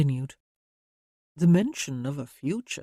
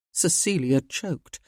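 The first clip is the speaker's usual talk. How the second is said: ordinary delivery, nothing marked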